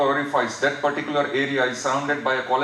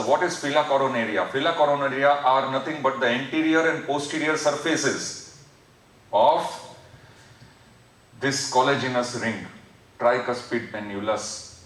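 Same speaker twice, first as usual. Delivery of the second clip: ordinary talk, nothing marked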